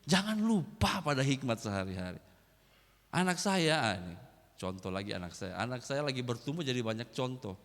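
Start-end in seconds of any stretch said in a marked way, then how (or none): none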